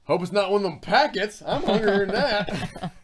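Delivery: loud, high-pitched hungry whining through laughs; mock freeloader. Freeloading housemates nagging Paul for dinner, playful mock whining. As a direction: pester the host loudly for food, half laughing.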